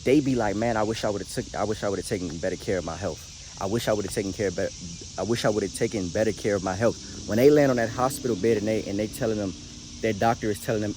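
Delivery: ordinary delivery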